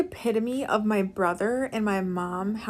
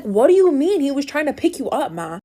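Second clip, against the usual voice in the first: a louder, exasperated brother, pitch up; mock-angry louder retort; 4 semitones higher, 8 dB louder than usual. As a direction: demand it like an annoyed son. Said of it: "brother's getting increasingly angry".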